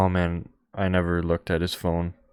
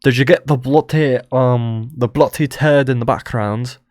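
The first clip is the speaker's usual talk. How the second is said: light mock Scottish accent, a bit louder; a hint of Scottish accent; imitating a Scottish accent; 4 semitones higher, 5 dB louder than usual. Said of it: "he either said in a British accent or Scottish accent".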